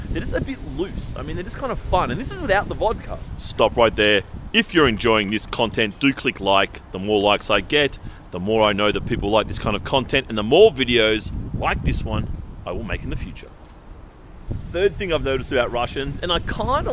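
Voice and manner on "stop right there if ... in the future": lower, louder ad-read voice; mock announcer plug, lower and louder; promo aside. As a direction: switch into a YouTube plug. Breaks in with a like request.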